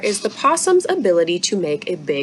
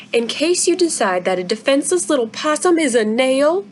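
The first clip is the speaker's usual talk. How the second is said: put-on indignant young girl; 6 semitones higher than usual. Izzy accusing her father.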